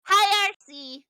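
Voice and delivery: high, loud, slow sing-song hello; bright stretched greeting; excited greeting. Acted. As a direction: call out happily to a friend. Greeting the robot.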